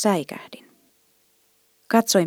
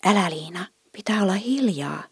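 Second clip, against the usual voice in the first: softer hushing whisper, no voice; child whispering to sister in church to be quiet; 3 dB quieter than usual. A narrator's reading. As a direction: hush the little sister in a whisper. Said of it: "minä kuiskasin".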